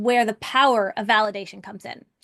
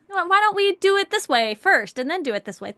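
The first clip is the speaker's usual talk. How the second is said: lightly acted, higher-pitched proposal; imitated husband's suggestion; 7 semitones higher, 4 dB louder than usual. Coach retells her husband proposing his order of doing things, lightly imitated.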